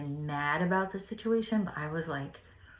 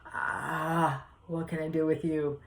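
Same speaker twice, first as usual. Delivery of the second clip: exasperated groaned sigh into a retold plea; exasperation; slower than usual. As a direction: act out mild exasperation at a child. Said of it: "Sometimes my aggression is more like".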